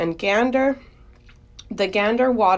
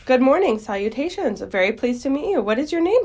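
high, bubbling enthusiasm; excited rush of pleasantries; eager greeting; 6 semitones higher, 3 dB louder than usual. Acted. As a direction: gush at meeting a new friend. Wilbur eagerly greets new friend.

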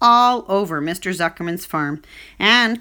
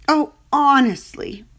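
tearful; 6 semitones higher, 4 dB louder than usual. Acted. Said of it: "Wilbur was crying again".

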